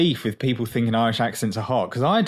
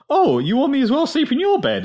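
mock eager boy; 10 semitones higher than usual. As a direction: mockingly act the too-willing boy, pitched way up. Mocks boy agreeing too eagerly.

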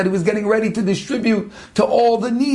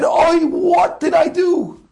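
higher, slow, shouted anguish; horrified outcry; 7 semitones higher, slower than usual. Acted. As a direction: cry out in horror at what he did. Murderer horrified at his deed.

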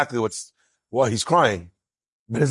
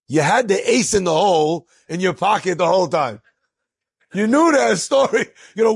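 much higher and louder, laughing, playfully accusing; laughing mock accusation; 9 semitones higher, 4 dB louder than usual. Rabbi [name] ribbing his partner about the hidden story, high laughing mock outrage. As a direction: needle your friend with a delighted laugh. [name] teases Levine after the win.

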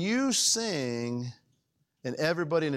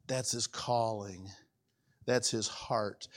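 quiet emphasis; 9 semitones lower, 6 dB quieter, much slower than usual. Acted. Pastor driving home the point.

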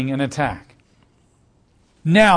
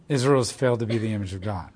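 softer, lower, quicker repeat of the same line; quiet repeat; 6 semitones lower, 6 dB quieter, faster than usual. Real, not acted. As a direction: let it sink in, dropping the voice. Preacher repeats the point for emphasis.

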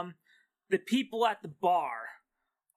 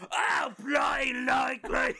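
put-on drunk British shout; 5 semitones higher than usual. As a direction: shout like a cranky drunk Brit. Drunk patron refused service.

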